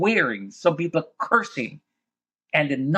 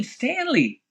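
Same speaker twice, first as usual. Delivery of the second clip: much higher mild mock yell of a name; retold scream; 12 semitones higher than usual. The friend calling for Stanley, a high mild mock yell. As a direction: call out the name, echoing the scream. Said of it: "I can hear the scream".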